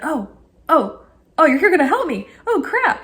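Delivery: a startled, laughing "oh crap"; startled panic. Retold or imitated. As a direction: voice jumps high in surprise. Retold panic at the call.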